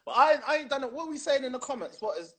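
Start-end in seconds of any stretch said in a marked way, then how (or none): none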